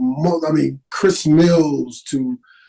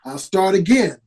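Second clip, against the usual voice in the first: normal talking voice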